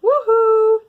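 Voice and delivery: a loud, very high whoop of joy; excited whoop. Real, not acted. Vlogger whoops over a rare pattern book. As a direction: cheer it out like you won.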